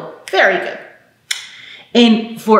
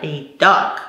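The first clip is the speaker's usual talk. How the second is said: usual speaking voice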